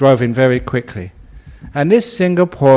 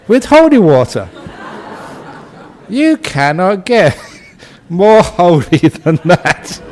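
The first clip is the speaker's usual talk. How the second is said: laughing through the words, louder; 4 semitones higher, 5 dB louder than usual. Real, not acted. Monk tells audience the snake was blessed, laughing. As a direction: chuckle the punchline out. Joke about peeing on snake.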